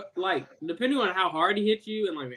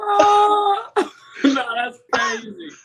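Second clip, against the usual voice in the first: laughing; 10 semitones higher, 7 dB louder, much slower than usual. Real, not acted. Laughing reaction to chop talk.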